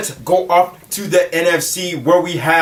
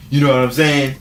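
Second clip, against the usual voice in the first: ordinary delivery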